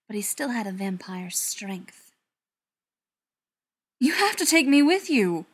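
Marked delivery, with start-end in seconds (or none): 4.0-5.5 loud, high, insistent demand. A narrator's reading. Ryan demanding to come along, loud and insistent. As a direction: insist urgently, voice raised. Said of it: "Ryan demanded".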